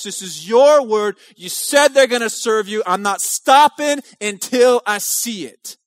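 loud, fiery preaching, pushing each line forward; preaching at full force. Acted. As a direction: thunder out the prayer like a revival preacher. Retells mother's prayer, preaching.

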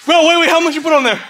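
fast, high panicked question. Real, not acted. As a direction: rush it out in alarm. Panicked question during challenge.

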